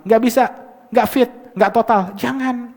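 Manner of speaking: loud, high-pitched warning; emphatic admonishing. Real, not acted. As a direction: wave them off forcefully. Repeats the stern warning.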